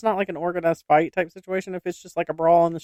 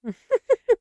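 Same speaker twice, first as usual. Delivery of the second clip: imitated child's giggle. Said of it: "she'll look over at us and go".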